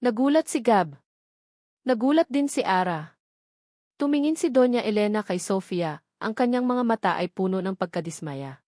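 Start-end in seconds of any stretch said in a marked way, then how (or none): none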